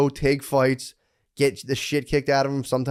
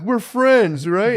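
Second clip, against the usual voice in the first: much higher, louder chipper dad; a dad cheerily introducing his daughters to his opponent; cheery dad voice; 10 semitones higher, 7 dB louder, much slower than usual. Retold or imitated. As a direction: sell the friendship brightly and loud. Dad voiced making peace with opponent.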